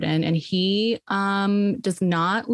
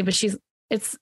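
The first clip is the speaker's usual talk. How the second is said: usual speaking voice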